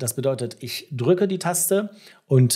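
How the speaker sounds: ordinary talk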